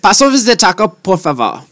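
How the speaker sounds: Spanish with a mock German accent. Shown as a demonstration. Podcaster demos a German-Mexican dog's accent, German-accented Spanish. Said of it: "speaking in Spanish with a German accent".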